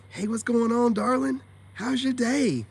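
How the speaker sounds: high, bright and loud, cooing at the wife; sweet husband greeting. Retold or imitated. Married man greeting his wife after arguing online, sugary high voice. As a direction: play the doting husband home from work. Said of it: "go home and be like".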